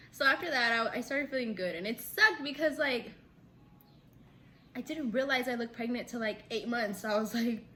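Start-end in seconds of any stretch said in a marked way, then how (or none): none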